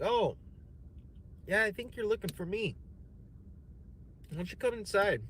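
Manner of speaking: high, sing-song invitation; mock seductive host. Rich man inviting the comic in, high mock-inviting. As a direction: lure him in with a high, sweet voice.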